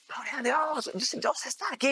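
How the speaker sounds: high, excited cries of praise; retold joyful shouting. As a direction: shout it out like a crowd praising God. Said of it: "empezaron a gritar". (gritos de alabanza, agudos y emocionados)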